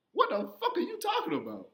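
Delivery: high-pitched mock disbelief; retold panicked protest. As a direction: squeal it like a cornered kid. Child protesting to threatening father.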